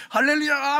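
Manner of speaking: high, loud hallelujah cheer; overjoyed. Acted. Elder cheering on the golf course, wildly overjoyed. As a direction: whoop it out in acted jubilation. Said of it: "골프장에서".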